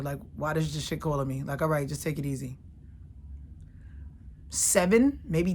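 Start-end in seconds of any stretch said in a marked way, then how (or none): 0.4-1.4 low, soft, couldn't-care-less voice; mock indifference. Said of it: "like, doesn't care". 1.6-2.6 low, soft brush-off; quiet, lower-pitched, unbothered; mock indifference. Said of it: "like, doesn't care".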